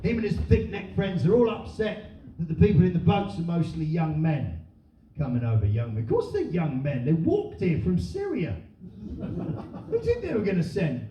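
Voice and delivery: usual speaking voice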